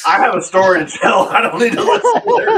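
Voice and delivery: high laughing protest. Real, not acted. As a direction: laugh through the words. Laughing while muting relative.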